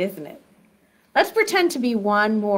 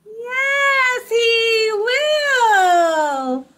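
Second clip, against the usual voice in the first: high sung burst of joy; spring up like a jack-in-the-box; high excited singsong; excited pop-out; 9 semitones higher, 3 dB louder, much slower than usual. Shown as a demonstration. Jack pops out.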